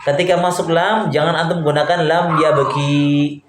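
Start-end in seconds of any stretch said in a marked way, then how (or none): 2.0-3.4 "lam ya beki" with the last vowel held too long; wrong long vowel. Shown as a demonstration. Teacher shows students the forbidden form, long final "i" in "beki". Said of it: "nggak boleh panjang".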